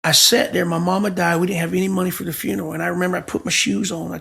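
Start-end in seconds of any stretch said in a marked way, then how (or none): none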